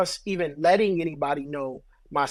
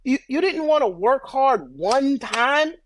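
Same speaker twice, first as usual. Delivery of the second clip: higher, louder mock disbelief, drawn out; incredulous reproach; 9 semitones higher, 5 dB louder than usual. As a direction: slowly challenge them in exaggerated disbelief. Acted reproach to an unsuccessful other.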